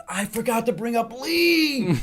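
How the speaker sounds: high, slow, drawn-out wail; hammed-up regret, rising high; mock anguish. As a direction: stretch it out in comic dismay. Retells his own dismay.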